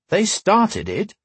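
slow, high-pitched protest. A narrator's reading. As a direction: push back like a stubborn son. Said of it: "protested Matt".

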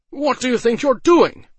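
high, loud furious yell. A narrator's reading. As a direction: bellow it at the intruder. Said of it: "Lysnam yelled".

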